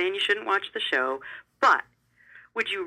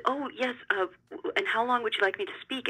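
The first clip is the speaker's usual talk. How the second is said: higher, bright put-on calm while flustered; faking composure; 6 semitones higher, 3 dB quieter than usual. Told to give a keynote, faking calm and readiness. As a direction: act unflustered, voice up a bit. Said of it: "try not to look flustered".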